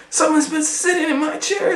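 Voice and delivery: high, fast cartoon bear voice; Papa Bear. Papa Bear finding his chair used, high and fast. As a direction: rattle it off as the cross bear. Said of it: "said Papa Bear".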